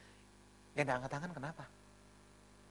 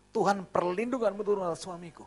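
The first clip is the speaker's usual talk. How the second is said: raised, loud fervent prayer; doa istri; 6 semitones higher, 5 dB louder than usual. Retold or imitated. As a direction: pray earnestly over your husband, voice lifted. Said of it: "Dalam doanya saya dengar". (doa istri yang lantang dan sungguh-sungguh)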